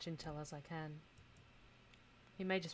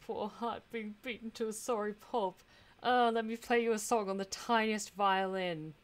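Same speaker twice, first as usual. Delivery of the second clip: sarcastic; 5 semitones higher, 10 dB louder than usual. Acted. Mocking himself after rejection.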